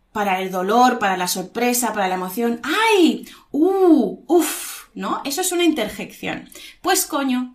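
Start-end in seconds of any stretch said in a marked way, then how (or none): 2.6-4.9 string of high exclamations; demo interjections. Shown as a demonstration. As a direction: show off emotional sounds one by one. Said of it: "mostramos nuestras emociones con sonidos".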